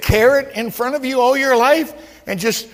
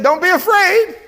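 voicing Jesus; 7 semitones higher, 4 dB louder than usual. Jesus reassuring the disciples in the storm, high and loud. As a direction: call out comfort over the storm.

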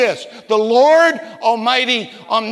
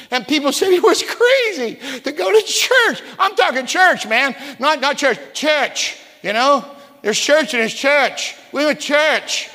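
church hit hard, higher; preaching emphasis; 4 semitones higher than usual. Real, not acted. Preacher telling the congregation about camp meetings, emphatic. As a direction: lean on every church.

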